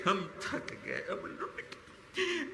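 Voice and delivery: words almost lost to grief; broken whisper. Real, not acted. The grieving preacher telling God they are worn out, in a broken whisper.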